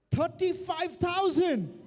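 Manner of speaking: excited call. Real, not acted. Auctioneer calling a higher bid.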